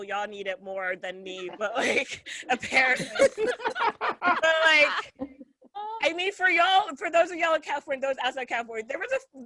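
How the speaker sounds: normal talking voice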